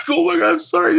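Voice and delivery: very high, loud, surprised sorry; startled apology. Retold or imitated. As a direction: caught off guard, apologize fast. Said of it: "And I was like".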